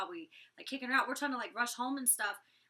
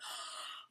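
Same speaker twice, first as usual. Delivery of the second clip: quick imitated gasp; mock shocked gasp; 10 dB quieter than usual. All the girls reacting to the lips insult, mock shocked gasp. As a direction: gasp like scandalized onlookers.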